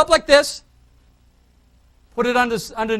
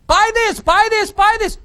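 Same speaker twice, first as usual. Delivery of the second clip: higher, louder hammed-up pushy ad voice; mock a hard-selling ad, over and over; pushy salesman; 10 semitones higher, 6 dB louder than usual. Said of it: "Traditional is".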